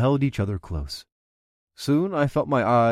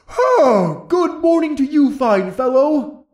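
much higher, louder cheerful voice; jolly character voice; 14 semitones higher, 7 dB louder, slower than usual. Acted. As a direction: greet them with a wide grin. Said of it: "Bungal declared with a wide grin".

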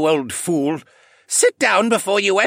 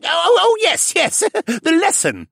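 put-on high, loud, giggly old koala; sudden recollection; 5 dB louder than usual. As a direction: remember it with glee. Jenner remembers suddenly.

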